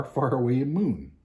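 usual speaking voice

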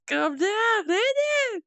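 very high squeal of amused protest; laughing shriek. Real, not acted. Streamer to a dude doing spin kicks, laughing shriek.

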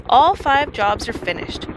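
ordinary delivery, nothing marked

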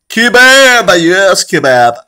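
very high, loud whoop over food; loud celebratory outburst; exuberant shout. Real, not acted. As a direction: belt it out like a cheer, pitch dropping at the end. Exclaiming over last night's kebab.

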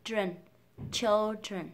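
put-on "children" with dark L then "dren"; correct children. Shown as a demonstration. Teacher modelling the fix, "children" in two full syllables. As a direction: sound both L and DR in "children". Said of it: "Đầu tiên là thiếu âm ơ".

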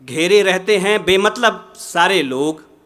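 measured, projected ghazal recitation with pauses; poetry recitation. Acted. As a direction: recite the couplet to a mushaira audience.

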